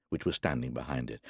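ordinary delivery

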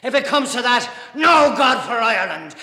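very loud, high angry shout; furious defiance. Acted. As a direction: roar it defiantly across the table. Brought it down on the table.

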